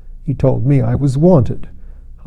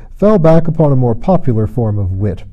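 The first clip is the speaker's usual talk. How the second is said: usual speaking voice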